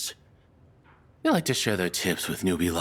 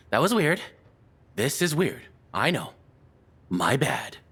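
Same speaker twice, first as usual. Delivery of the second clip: embarrassed flustered; 6 semitones higher than usual. Acted. Captor catches herself, embarrassed.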